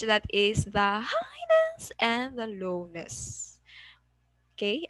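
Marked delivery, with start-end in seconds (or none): 1.0-1.9 "highness" sung out high and drawn out; high pitch. Shown as a demonstration. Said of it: "So when we say pitch". 2.5-3.6 "lowness" dropped back down and drawn out; low pitch. Shown as a demonstration. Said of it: "So when we say pitch".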